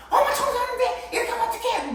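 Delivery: very high-pitched, acted mum's outburst; high, sustained mock scolding; scolding mother. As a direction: play an exasperated mother telling off her child. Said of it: "아까 엄마처럼".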